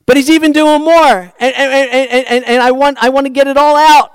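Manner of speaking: high, loud, tumbling eager rush; Wesley bursting to say it all at once; excited Wesley quote. Acted. Preacher voicing Wesley's eager overflowing rush. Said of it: "He says".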